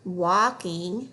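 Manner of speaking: "walking and talking" read slowly and evenly; slow fluency read. Shown as a demonstration. Teacher reading a fluency phrase slowly. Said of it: "Next one".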